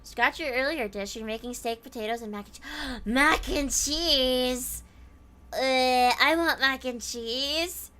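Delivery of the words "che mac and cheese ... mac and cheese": louder, drawn-out mac and cheese; real delighted longing; excited craving. Real, not acted. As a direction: gasp and demand it eagerly. Excited by the dinner suggestion.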